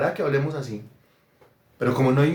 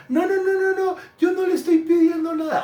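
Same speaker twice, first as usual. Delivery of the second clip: high-pitched mock parental refusal; protesting parent; 13 semitones higher than usual. A parent turning down a child's money, mock high protest. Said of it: "algunos papás".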